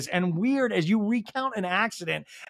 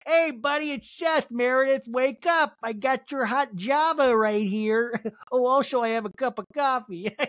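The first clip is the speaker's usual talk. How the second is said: hammed-up higher-pitched goofy morning wake-up; Seth; 4 semitones higher than usual. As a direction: play a pleased-with-his-own-joke boyfriend, pitched up. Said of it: "can you imagine, like".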